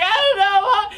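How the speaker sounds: hammed-up high, loud blubbering; mock crying, words tumbling into whimpers; verge of tears. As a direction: wail incoherently to mom through mock sobs. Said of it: "on the verge of tears".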